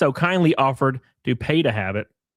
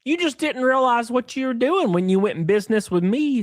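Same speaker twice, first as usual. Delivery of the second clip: high, sneering mock of the business partner; mocking Jeremy; 10 semitones higher than usual. Streamer mocking the partner's smug reply, high and sneering. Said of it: "he said".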